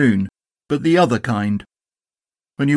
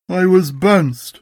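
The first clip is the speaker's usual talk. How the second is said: slow, glum and a bit higher; gloomy donkey voice; 6 semitones higher, slower than usual. Acted. Eeyore complains to his friends, slow and gloomy. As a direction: drag it out like a weary old donkey. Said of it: "said Eeyore".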